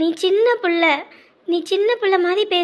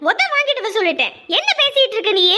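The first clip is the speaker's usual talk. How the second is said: usual speaking voice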